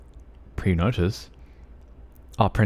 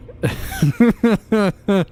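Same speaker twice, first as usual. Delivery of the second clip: laughing; 11 semitones higher, 3 dB louder than usual. Real, not acted. Laughing at own accent bit.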